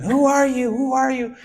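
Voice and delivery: sharp high yell at a stranger; retold scream. Motel owner shouting at a strange boy in her garage. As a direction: demand who he is, shouting. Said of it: "a lady comes out and screaming".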